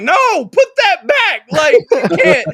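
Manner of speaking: husband begging the doctor to stop, loud and high; horrified yell. Retold or imitated. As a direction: yell it in horror. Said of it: "And I'm like".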